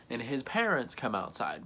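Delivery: usual speaking voice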